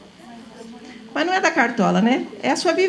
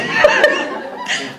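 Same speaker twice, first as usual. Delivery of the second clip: a breathy laugh, much higher; high voiceless laugh. Real, not acted. Teacher laughing after quoting the old lady, breathy laugh. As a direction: laugh at the memory.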